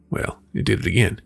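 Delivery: ordinary delivery, nothing marked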